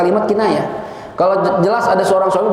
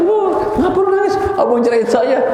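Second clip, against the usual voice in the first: mock dialogue; 7 semitones higher than usual. Husband and crying wife dialogue.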